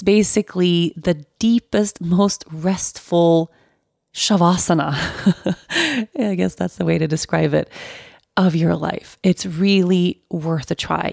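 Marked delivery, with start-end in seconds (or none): 5.0-6.1 amused laugh. Real, not acted. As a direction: chuckle lightly at your own words. Amused at own wording.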